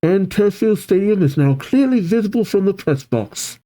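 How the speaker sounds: high-pitched stadium announcer. Acted. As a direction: call it out to the crowd. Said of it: "The announcer says".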